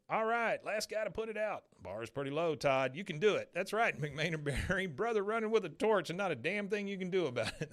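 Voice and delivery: mock pep-talk bravado; announcer guy. Torch guy hyping the next runner, lightly hammed. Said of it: "the guy goes".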